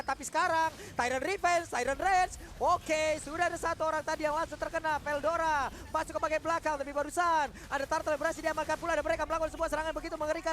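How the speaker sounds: loud fast play-by-play; fighting game announcer. Acted. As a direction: call the fight live, full energy. Live teamfight play-by-play. (suara komentator pertandingan yang bersemangat)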